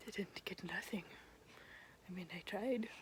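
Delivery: confiding whisper. Real, not acted. Telling viewers about the toilet, in a whisper. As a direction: whisper it like an embarrassing secret.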